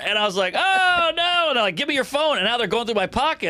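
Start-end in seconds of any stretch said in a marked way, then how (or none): 0.6-1.4 high, drawn-out comic wail; mock dread. New prisoner as cellmates spot his phone, mock dread. Retold dread at being caught. 1.8-2.4 high-pitched insistent order; mock cellmate demand. Cellmate to the new guy, mock insistent demand.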